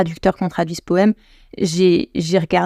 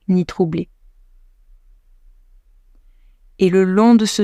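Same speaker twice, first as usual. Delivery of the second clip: slow, measured poetry reading; slow, reverent verse reading; poem recitation; slower than usual. Acted. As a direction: recite it line by line, with long pauses. Recites her poem translation.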